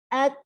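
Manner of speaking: "ak" with the k stopped, then a puff of breath; stopped letter demo. Shown as a demonstration. Teacher shows the class the stopped "ak". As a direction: brake on the k of "ak", then let air out. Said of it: "baru hamesnya muncul setelah mengucapkan K-nya tadi".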